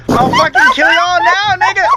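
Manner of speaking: high, loud, laughing fake threat; laughing mock rage. Real, not acted. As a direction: shout the threat while cracking up.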